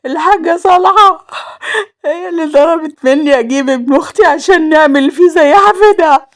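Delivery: high-pitched wailing confession; crying harder. A narrator's reading. Roaya naming the woman to Salem, crying harder. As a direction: blurt it out through heavy tears. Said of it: "بكت أكتر وقالت".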